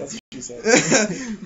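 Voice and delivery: slow, drawn-out joke with a laugh. Real, not acted. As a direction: land the punchline through a laugh.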